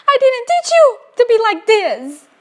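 high, hammed-up wail of reproach; mock outraged scolding. Mother scolds daughter in the game, mock wailing reproach. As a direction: play the wounded mum telling off her child.

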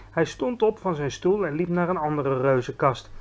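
ordinary delivery

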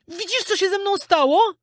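very high-pitched puppet question; alarmed puppet. A narrator's reading. Puppet showing Knot his donkey ears, alarmed. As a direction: ask it anxiously, way up high.

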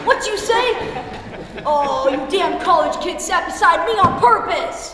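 loud, high-pitched indignation; riled-up, loud grumbling; angry outburst. Acted. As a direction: round on them, raising the voice. Old man riled by heckling youngsters.

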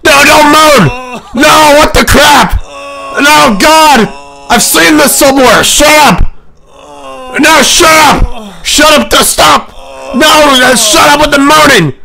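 very high, very loud scream of protest; furious screaming. Real, not acted. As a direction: scream to make the track stop. Screaming at the moaning track.

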